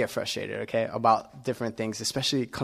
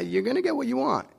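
much higher, fast, airy voice of God; God's voice; 12 semitones higher, faster than usual. Retold or imitated. Pastor voicing God answering a complainer, high and quick. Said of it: "And God's saying".

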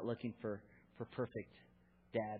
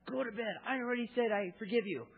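higher, louder exasperated dad; mock yell; 10 semitones higher, 6 dB louder than usual. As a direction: vent it in a hammed-up shout. Mock yelling at daughter.

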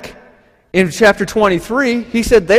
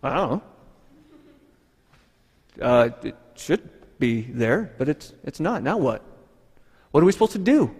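softer, lower, lost and confused; puzzled murmur; 7 semitones lower, 8 dB quieter than usual. Acted. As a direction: mumble as if staring into the empty tomb. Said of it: "He's standing there going".

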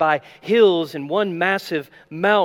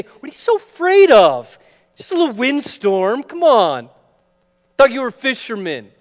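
much higher and louder sneering taunt; mocking scorn; 7 semitones higher, 8 dB louder than usual. Imagined Jesus scorning frightened fishermen, mocking taunt. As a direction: jeer at them for being scared. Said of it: "doesn't mock them or scorn them and say".

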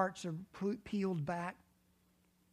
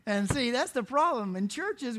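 normal talking voice

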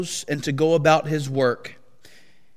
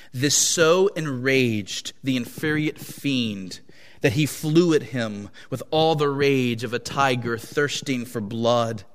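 ordinary delivery, nothing marked

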